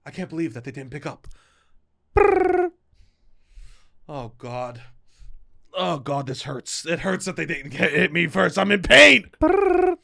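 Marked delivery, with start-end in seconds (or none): 2.2-2.7 high rolled brrr like a ringer; imitating a ringing phone, high trill. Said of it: "Do the rings for me". 9.4-9.9 high rolled brrr like a ringer; imitating a ringing phone, high trill. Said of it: "Do the rings for me".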